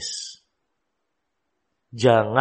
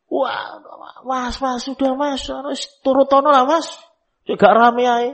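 high-pitched pleading wife voice; 13 semitones higher than usual. Retold or imitated. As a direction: beg your husband in a much higher voice. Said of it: "ibu negara ini". (istri memohon ke suami, suara tinggi merengek)

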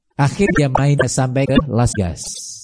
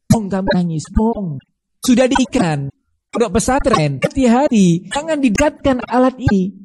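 rising rebuke; 8 semitones higher than usual. Acted. Preacher scolding parents, rising pitch.

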